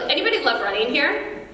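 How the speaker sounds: ordinary delivery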